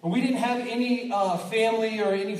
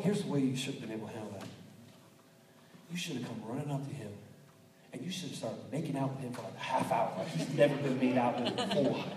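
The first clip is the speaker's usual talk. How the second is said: half joking aside; 7 semitones lower, 7 dB quieter, faster than usual. Real, not acted. Half joking advice, low fast.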